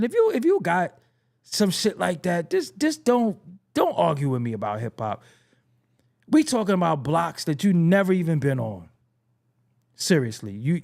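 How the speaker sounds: ordinary talk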